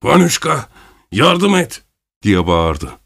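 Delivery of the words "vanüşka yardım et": a wild cry for help, much higher; a desperate, savage call; wild shout. A narrator's reading. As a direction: yell to the friend mid-fight. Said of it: "vahşi bir sesle ... diye bağırdı".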